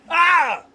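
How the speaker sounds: short, high startled cry; startled yelp. Real, not acted. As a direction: yelp as a fly gets you. Startled by a fly.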